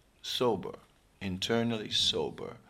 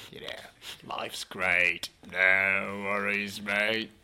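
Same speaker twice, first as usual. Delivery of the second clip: put-on slow, easygoing Australian accent; slower than usual. Speaker mocking Australians, in a slow laid-back Australian accent. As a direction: lean into a laid-back Aussie voice. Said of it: "slightly Australian".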